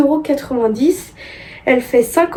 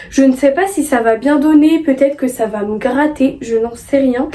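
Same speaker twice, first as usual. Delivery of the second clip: ordinary talk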